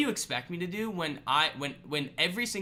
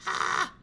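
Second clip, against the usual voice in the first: short, loud mock-panic scream; 4 dB louder than usual. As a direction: let out a sudden fake scream. Mock alarm at nuking prices.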